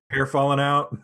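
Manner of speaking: laughing through the words. Real, not acted. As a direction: chuckle while saying it.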